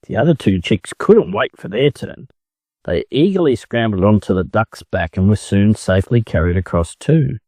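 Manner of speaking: ordinary delivery, nothing marked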